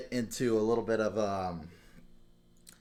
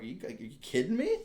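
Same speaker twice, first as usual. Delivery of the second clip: high, incredulous retort, a touch mocking; livid disbelief; 7 semitones higher than usual. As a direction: repeat the angry question to the troll in disbelief. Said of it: "I was livid".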